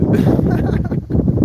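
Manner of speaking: squealing high laugh. Real, not acted. To viewers, laughing at her own skit, high squeal. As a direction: burst into a high giggle.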